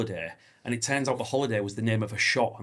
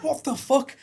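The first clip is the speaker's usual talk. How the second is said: shocked exclamation; 3 dB louder, slower than usual. Retold or imitated. Retold shock at story.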